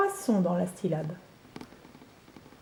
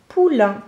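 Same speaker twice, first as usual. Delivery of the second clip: "Poulain" said louder, clear falling tune; dictation word; 6 dB louder than usual. Shown as a demonstration. Teacher dictating "Poulain" to a pupil. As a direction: announce "Poulain" firmly for dictation. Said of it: "Le poulain, c'est le petit du cheval".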